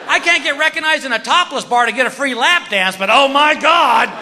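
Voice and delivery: high-pitched comic outburst; indignant mock rant. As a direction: build to a high, incredulous yell. Acted indignant rant at doctor.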